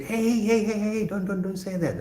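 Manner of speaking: a hint of telling a child off; mock scolding teacher. Teacher retelling how he stopped his student, light mock scolding. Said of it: "I said".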